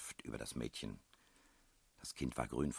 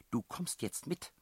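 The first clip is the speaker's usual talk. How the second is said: high-pitched, controlled command; stern order; 11 semitones higher than usual. A narrator's reading. Headmistress orders child to come, stern high voice. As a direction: order the child along, tolerating no argument.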